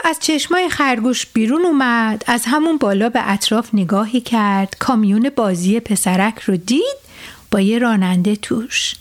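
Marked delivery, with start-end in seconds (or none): none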